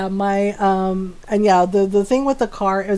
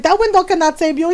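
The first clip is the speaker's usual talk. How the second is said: mock threat; 7 semitones higher, faster than usual. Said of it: "She's like".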